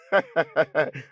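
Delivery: incredulous laughter. Acted. Son finds the name crazy, laughs in disbelief. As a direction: chuckle in sheer disbelief.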